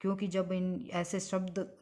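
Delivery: ordinary delivery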